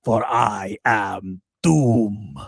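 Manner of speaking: slow, low, hammy European villain; Doom in a badly faked European accent, ponderously slow; terrible European accent. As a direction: lay on a bad European accent, deep and drawn out. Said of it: "that is a terrible, terrible European accent".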